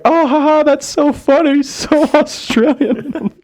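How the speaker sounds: high, gushing mock of the girl, slowing on the last words; gushing American girl. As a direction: ham up a starstruck girl cooing. Mimicking the impressed American girl.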